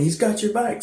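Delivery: high-pitched mock yell; retold scream. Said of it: "my dad starts screaming".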